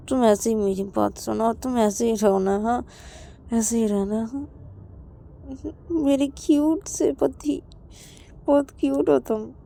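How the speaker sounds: tender. Acted.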